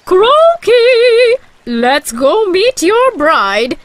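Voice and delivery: high, excited toad mother calling. A narrator's reading. Toad waking her son to meet his bride, excited. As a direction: call out eagerly, very high and drawn out. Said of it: "she said excitedly".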